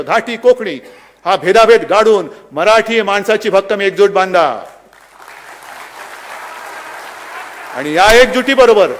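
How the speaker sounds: loud, rousing political oratory; fiery rally speech. Real, not acted. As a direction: thunder it to the crowd. Rally speech at full force. (जोशपूर्ण सभेचे भाषण)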